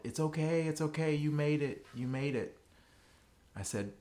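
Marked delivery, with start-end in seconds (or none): 0.0-2.6 retold yell. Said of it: "she yelled".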